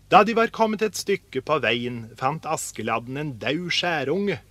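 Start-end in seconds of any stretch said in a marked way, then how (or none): none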